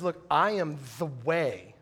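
slow, grave, measured declaration; lightly voiced Jesus, unhurried and weighty; a preacher quoting Jesus with slow gravity; solemn Jesus quote. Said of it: "Jesus says".

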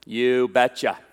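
put-on low, drawn-out Norwegian accent; thick Norwegian accent. Norwegian father answering marriage request, low accented impression. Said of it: "in his thick Norwegian accent".

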